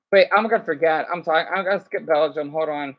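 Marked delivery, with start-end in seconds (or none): none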